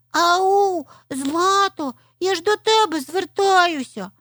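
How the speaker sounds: high little fox hailing someone; high-pitched insistent call; loud calling out. A narrator's reading. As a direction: call out high, drawing out the words. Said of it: "голосно вигукнула Аліска".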